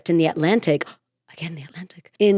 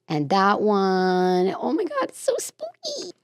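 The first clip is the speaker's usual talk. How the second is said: spooky delight; 4 dB quieter than usual. Real, not acted. Gushing over a spooky card.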